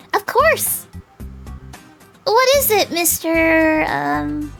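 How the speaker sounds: put-on high, loud, obliging girl; perky high girl voice; eager dandelion girl. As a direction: answer brightly, then grope for a name.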